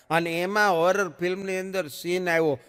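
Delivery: ordinary delivery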